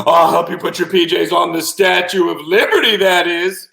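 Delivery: high, loud proclamation; gleeful octopus. Acted. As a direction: call it out from a great height. Octopus shouts from atop the statue.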